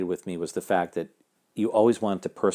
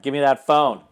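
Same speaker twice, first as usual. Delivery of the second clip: stern judge; 6 semitones higher, 6 dB louder than usual. Retold or imitated. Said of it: "They're like".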